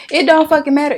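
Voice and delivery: loud, high, fed-up comeback; angry retort. Real, not acted. Vlogger answering nosy mortgage company, angry and loud. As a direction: throw it back hard and exasperated. Snapping back at the company's question.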